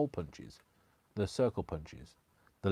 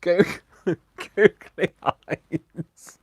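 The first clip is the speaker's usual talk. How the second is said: laughing; 9 semitones higher, 10 dB louder, much slower than usual. Real, not acted. Laughing, drawn out.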